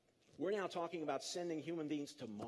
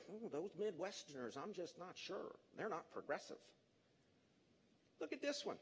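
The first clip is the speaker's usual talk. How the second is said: hushed, much softer mock coastal liberal; mock snooty liberal; 8 dB quieter than usual. Speaker mocking a liberal who doubts Midwesterners, hushed snooty voice. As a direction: sniff at the Midwest under your breath. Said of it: "if you're a thoroughbred liberal".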